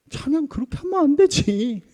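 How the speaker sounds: high, loud mock telling-off; nagging scold. Longtime churchgoer correcting a newcomer's praise, loudly nagging. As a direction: scold like an exasperated busybody, pitched right up. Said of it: "막 그렇게 잔소리를 하는 거예요".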